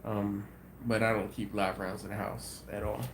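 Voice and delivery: normal talking voice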